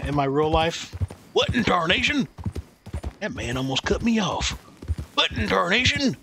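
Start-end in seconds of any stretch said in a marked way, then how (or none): 1.3-2.3 high Texan cowboy outburst; cowboy exclamation. Retold or imitated. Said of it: "every time something happens". 5.1-6.1 high Texan cowboy outburst; cowboy exclamation. Retold or imitated. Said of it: "every time something happens".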